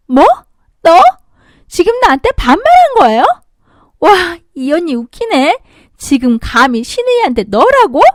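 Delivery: high, loud, outraged squeal; indignant brat. Acted. Sister-in-law outraged at being spoken down to, indignant. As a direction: shriek in disbelief.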